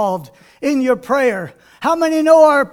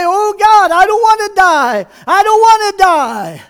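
high, louder desperate plea to God; pleading prayer; 5 semitones higher, 4 dB louder than usual. Acted. Hezekiah praying to God, a raised desperate plea. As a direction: beg God not to let him die. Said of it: "he begins to pray".

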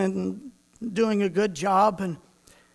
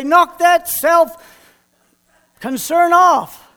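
louder and slower, drawn-out scold; slow loud rebuke; 5 semitones higher, 6 dB louder, much slower than usual. Retold or imitated. Preacher scolding his younger self, slow and loud. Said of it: "reach up and pop you and say".

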